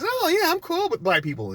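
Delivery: very high sugary fake voice; mock fake-friendly. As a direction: pretend to be an ally. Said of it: "smiling in your face".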